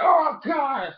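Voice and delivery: high mock dismay; hit fighter's cry. Imitates Ursaring being hit.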